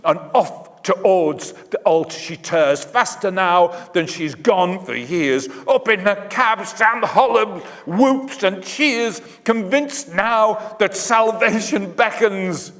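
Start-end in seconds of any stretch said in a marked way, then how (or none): 5.7-12.7 higher, eager storyteller voice lifting with the cheer; excited narration. A narrator's reading. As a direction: ride the thrill as Sam whoops. Triumphant moment in story climax.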